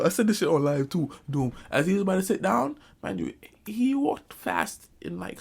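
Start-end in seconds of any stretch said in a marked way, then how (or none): none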